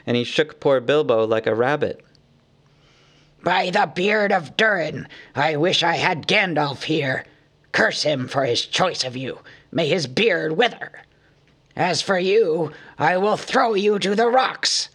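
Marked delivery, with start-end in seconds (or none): 3.4-14.9 angry cry. A narrator's reading. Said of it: "he cried".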